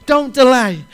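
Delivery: Don't delay, called out loud and high; loud urgent plea. Acted. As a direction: shout the warning Don't delay. Preacher voices Jesus urging Zacchaeus.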